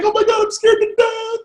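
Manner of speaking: high laughing mock fright; mock scared while laughing. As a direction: laugh through a fake panic. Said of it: "you just can't be smiling and be like".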